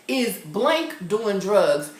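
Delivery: wolno, dobitnie pytając; slow deliberate question. Shown as a demonstration. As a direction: slowly lay out the question. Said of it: "If somebody says".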